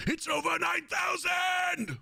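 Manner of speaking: high, slow yelled boast; Vegeta impression. Vegeta answering about the power level, high slow shout. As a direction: shout it out in disbelief. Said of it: "Dragon Ball Z Vegeta".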